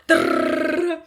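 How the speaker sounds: high, loud rolled drrrr for running feet; a loud rolling drumroll of footsteps; imitated footsteps rumble. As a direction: rumble it like a crowd stampeding.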